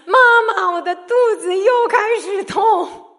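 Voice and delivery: high, loud crying child's wail, imitated; imitating a crying child. Mother imitating her son crying for mama. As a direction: wail like a sick little boy calling mum. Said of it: "开始喊说".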